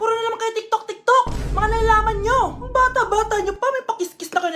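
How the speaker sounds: teacher scolding students about TikTok. Acted. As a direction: tell them off like a fed-up teacher.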